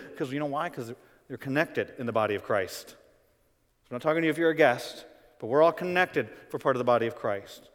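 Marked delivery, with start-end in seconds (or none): none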